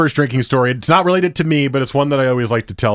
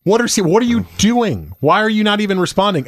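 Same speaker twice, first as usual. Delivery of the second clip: exasperated demand; 8 semitones higher than usual. Retold or imitated. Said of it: "And it was like".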